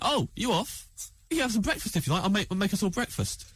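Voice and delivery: high, bright-eyed hostess offer, lightly done; chirpy mum voice. Retold or imitated. Comedian imitating a friend's mum offering breakfast, high sing-song.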